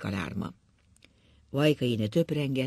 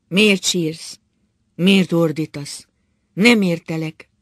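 loud, higher, demanding questions; hard, stern; 3 semitones higher, 9 dB louder, slower than usual. A narrator's reading. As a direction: press him harshly, phrase by phrase. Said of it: "Keményen beszélt". (keményen számonkérve)

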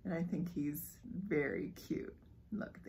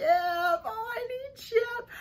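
much higher pleading whine; whiny longing; 11 semitones higher, slower than usual. Real, not acted. Vlogger wanting the Chip plush, pleading high.

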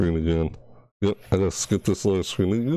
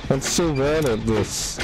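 flustered self-reproach; 6 semitones higher than usual. Real, not acted. Botches the generator.

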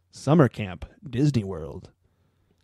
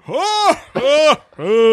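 boisterous laugh; 11 semitones higher, 7 dB louder, much slower than usual. Retold or imitated. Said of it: "like a girl that's like".

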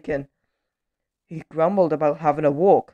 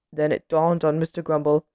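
plain reading voice

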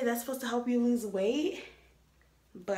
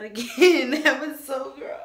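louder, laughing through a gag; laughing groan; 5 semitones higher, 7 dB louder than usual. Real, not acted. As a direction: laugh off the gagging.

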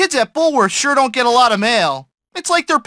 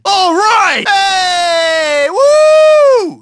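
excited cheer; 7 semitones higher, 5 dB louder, much slower than usual. Acted. Mailman cheers loudly.

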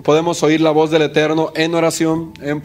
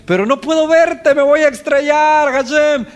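higher, louder panicked cry to God; desperate plea; 9 semitones higher, 4 dB louder than usual. Acted. Believer cries to Hashem in panic, higher and louder. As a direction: beg for help as if about to crash.

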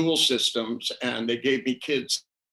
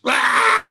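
sudden high, loud scream; mother's scream; 13 semitones higher, 8 dB louder than usual. Retold or imitated. Mother warning a child reaching for fire, screaming. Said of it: "your mother screams".

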